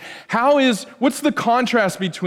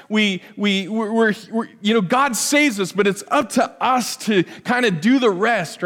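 usual speaking voice

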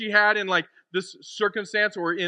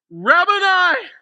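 loud, high, astonished cry; 11 semitones higher, 10 dB louder, slower than usual. Acted. Mary recognizing Jesus alive, crying out. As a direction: call out in overwhelmed recognition. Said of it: "cried out".

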